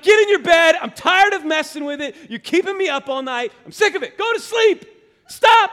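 high, full-volume angry dad shout; mad yelling. Retold or imitated. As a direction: re-enact yelling at a kid to sleep. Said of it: "I got mad".

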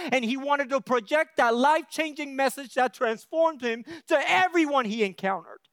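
loud, rising preacher's cry; preaching at full force. Acted. Preaching climax continues.

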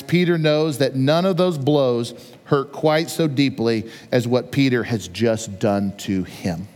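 slow, low-pitched, grave delivery; hushed-down pitch, weighty pacing; solemn quotation reading. Acted. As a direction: read it slowly, letting each phrase land. Reads professor's quote solemnly.

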